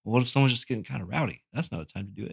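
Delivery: quietly thinking it through to himself; a hushed, uncertain inner voice; mild hushed musing. Retold or imitated. Kid behind the counter musing quietly about shooting.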